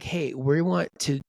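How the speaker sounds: ordinary talk, nothing marked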